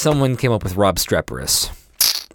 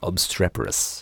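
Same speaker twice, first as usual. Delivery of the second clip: savoring the word; 3 semitones lower, 6 dB quieter, slower than usual. Shown as a demonstration. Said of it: "I like saying it".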